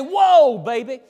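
loud, high "whoa", then dropping back; sudden booming exclamation, imitating dad; dad's excited whoa. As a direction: imitate dad calling out at a sight. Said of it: "he'd say".

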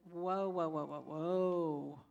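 slow, drawn-out mock whoas; retold stunned stop; startled whoa. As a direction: pull back in disbelief. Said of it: "And I said".

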